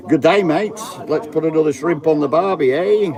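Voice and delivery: mock Australian accent. Jokey Australian accent to the camera crowd. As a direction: play a cheery Aussie bloke, lightly. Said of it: "I from Western Australia".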